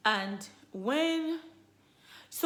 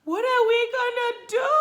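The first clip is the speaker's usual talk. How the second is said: much higher, loud panicked yell; panicked shout; 9 dB louder than usual. Retold or imitated. Husband panicking over twins, shouting. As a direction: burst out in alarm. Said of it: "That was what he, this guy was saying".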